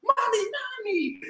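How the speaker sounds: high eager call; excited yell. Acted. Girl calls her mom, excited. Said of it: "Journey yelled excitedly".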